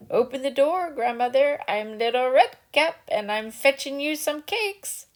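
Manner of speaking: a wolf sweetly pretending to be the girl; wolf faking a girl. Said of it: "knocked and cried".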